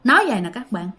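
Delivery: ordinary delivery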